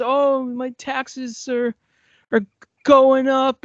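high, slow whining complaint; whiny taxpayer. Retold or imitated. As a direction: moan like a pissed taxpayer.

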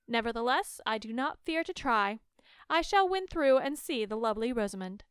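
put-on loud, determined young prince; bold prince. A narrator's reading. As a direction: declare it boldly like a brave prince. Prince declares he'll try.

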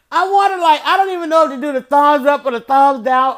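high, loud disbelief; can't believe what she's seeing; incredulous excitement. Real, not acted. Telling viewers about the Eagles bus, incredulous.

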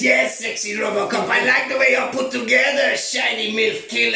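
loud, high, hammed-up Mexican accent; over-the-top mock-Mexican movie villain; Cheech Marin accent. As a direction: shout it like a bad Cheech Marin impression. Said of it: "All of your accents sound like Cheech Marin".

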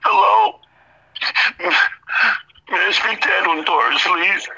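high, tearful phone-call put-on; pretend crying. Debtor phoning the collector from his manager's office, pretending to cry. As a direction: fake being on the verge of tears. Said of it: "pretending I'm crying".

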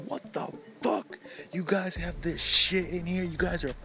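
high-pitched, slow angry chef rant; Gordon Ramsay yelling. Retold or imitated. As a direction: chew out the kitchen staff, slowly. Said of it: "he walks in".

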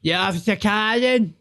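mock the nosy neighbour; sarcastic jibe. Mocking a neighbour who shouts at him about vans. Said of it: "mocking retort to neighbour".

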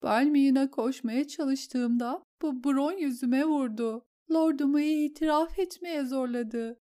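a shaken noblewoman reliving the horror; distraught lady. Acted. Lady tells the queen how the duel went wrong, tearful.

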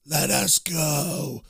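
eerie, stretched-out corpse voices; screaming corpses. Acted. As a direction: moan it out like reanimated dead pleading for release. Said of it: "they begin to scream".

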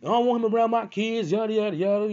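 very high, loud mock-female scolding; confrontational mother. Girlfriend in baby dad's face, high-pitched mock confrontation. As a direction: mimic her yelling in his face, high pitch. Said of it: "she in his face like".

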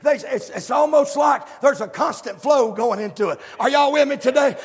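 loud sung whoop of a preacher at full cry; full-force preaching. Real, not acted. Preacher whooping the congregation about the throne, loud and sung. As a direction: preach it out, half singing, building to the top.

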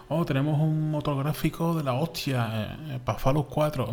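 high, mocking boast; mocking hype voice. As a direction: parody a smug developer pitch.

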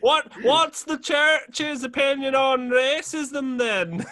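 high, loud, slow mock Irish accent, laughing through it; hammed-up Irish voice cracking with laughter. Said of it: "That one's the loud one".

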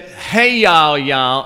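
hammed-up Southern drawl; Southern lady. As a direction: imitate a Nashville woman calling out. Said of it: "this one lady from Nashville".

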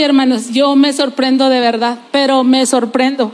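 ordinary talk, nothing marked

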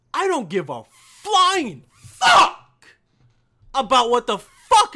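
slow, loud outburst against the media; furious shout. Real, not acted. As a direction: blow up at the news. Ranting outburst about the news.

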